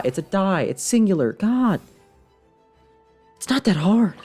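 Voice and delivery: high-pitched exasperated self-correction. Real, not acted. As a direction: scold yourself, voice jumping up in frustration. Scolding own word slip, exasperated.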